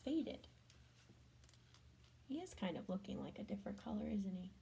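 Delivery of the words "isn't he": ordinary delivery, nothing marked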